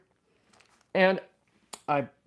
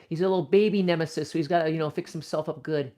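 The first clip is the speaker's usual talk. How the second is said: ordinary talk, nothing marked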